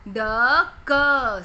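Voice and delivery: ड and क as separate held sounds; sounded out. Shown as a demonstration. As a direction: say ड, pause, then a loud long क. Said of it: "सी के दोनों आएंगे".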